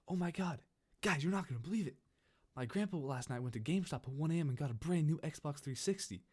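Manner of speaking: put-on high-pitched excited kid breaking big news; gushing, hyped-up retold boast. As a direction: imitate a friend bursting with news. Friend boasts about surprise new Xbox.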